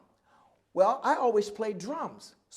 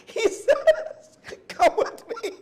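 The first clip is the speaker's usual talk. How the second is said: laughing through it; 13 semitones higher, 6 dB louder, much slower than usual. Real, not acted. Teller laughing through retold line.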